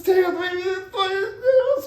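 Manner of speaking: slow, high, tearful plea; a grieving widow begging him to take back the news, crying. Retold or imitated. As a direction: wail it through tears. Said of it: "Screaming and yelling".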